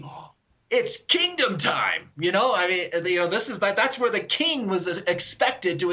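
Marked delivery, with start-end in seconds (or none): 0.0-2.1 higher, slow, savoring apostle's daydream; imitating excited apostle. Apostle imagining the kingdom arriving, slow mock excitement. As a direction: stretch it out like a thrilled disciple. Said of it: "And you're thinking".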